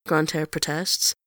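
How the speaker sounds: ordinary delivery, nothing marked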